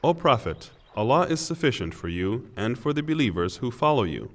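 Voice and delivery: usual speaking voice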